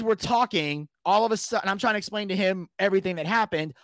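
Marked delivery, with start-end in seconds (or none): none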